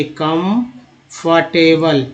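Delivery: कंफर्टेबल slowly, part by part; broken into parts. Shown as a demonstration. Teacher showing learners the parts, कंफर्टेबल slow and segmented. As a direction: split कंफर्टेबल after "com", drawn out. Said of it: "तोड़ तोड़ के कैसे पढ़ेंगे".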